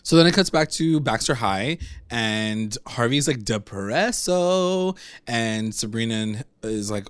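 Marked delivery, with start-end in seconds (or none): 3.4-5.0 DEPRESSO stretched very slowly; drawn-out mock gloom. Summing up gloomy Harvey, drawn-out mock gloom. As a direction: drag the word out, raised in pitch. Sums up Harvey's low mood.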